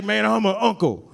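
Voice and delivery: a hint of a laid-back older guy; casual, drawn-in uncle voice; lightly played street uncle; lightly voiced uncle. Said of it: "He's like".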